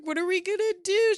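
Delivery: squeaky desperate question, pitch way up; mock-panicked whine; high panicked plea. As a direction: beg for help in a high panic.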